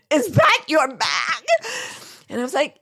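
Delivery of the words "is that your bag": slow, higher, alarmed question; wide-eyed question. Retold or imitated. Guards staring at the X-ray screen, wide-eyed slow question. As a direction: ask like you can't believe it. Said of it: "look at me with wide eyes and go".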